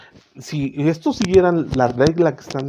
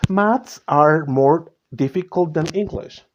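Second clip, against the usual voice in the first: slow example read; 3 semitones higher, slower than usual. Shown as a demonstration. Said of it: "teacher reads example slowly".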